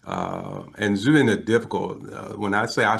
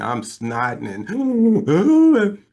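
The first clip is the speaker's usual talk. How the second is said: louder, drawn-out mock sobbing; bawling; 10 semitones higher, 6 dB louder, much slower than usual. Caller in tears on the phone, imitated bawling. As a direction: trail into acted blubbering. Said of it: "And I am bawling".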